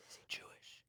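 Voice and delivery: hushed whisper so the mic misses it. Real, not acted. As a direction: drop to a breathy secret whisper. Asking not to be recorded.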